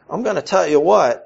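ordinary talk

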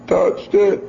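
put-on high little-kid voice owning up; toddler confessing. Dad voicing his son admitting he touched it, high child voice. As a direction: confess it simply, like a tiny child.

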